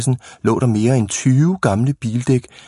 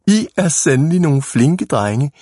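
put-on approving old lady; 6 semitones higher than usual. A narrator's reading. Lady praises the innocent-seeming boys.

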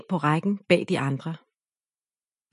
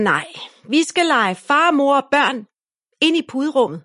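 shouting; 7 semitones higher, 4 dB louder than usual. A narrator's reading. Ida overruling Siri, loud bossy shout. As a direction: insist loudly on the new game. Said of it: "råber Ida".

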